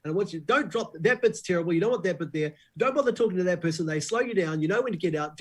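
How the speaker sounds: high, insistent critic on the phone; Paul Holmes. Retold or imitated. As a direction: rattle off orders as an imitated caller. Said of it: "He's like".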